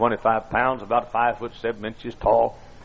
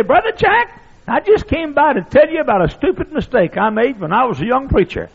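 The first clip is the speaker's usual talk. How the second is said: put-on squeaky old voice; 11 semitones higher, 4 dB louder than usual. Old preacher confiding in the pastor, squeaky. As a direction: open with a loud squeaky call. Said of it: "In a squeaky old voice".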